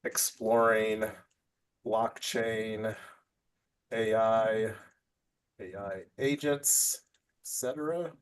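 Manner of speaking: slow, halting murmur while typing; dictating to self. Real, not acted. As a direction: read it out softly as you write it down. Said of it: "let me actually put family offices down here".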